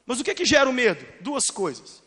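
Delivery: normal talking voice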